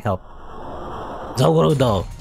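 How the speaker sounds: ordinary talk, nothing marked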